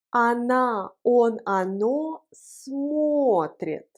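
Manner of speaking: very slow, pronouns set apart; slow model pronunciation. Shown as a demonstration. Teacher leading the joint conjugation, slow drawn-out model. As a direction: stretch out each word for learners. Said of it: "Prononçons ensemble la conjugaison".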